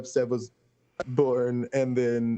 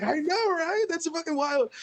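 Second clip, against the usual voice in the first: very high, squeaky excitement without extra volume; retold giddy agreement. New officiant to amazed guests, giddy agreement.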